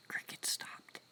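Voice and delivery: hushed whisper, listening to the dark. Real, not acted. Live streamer outdoors at night, hushing to listen: whispered. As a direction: whisper so the crickets start again.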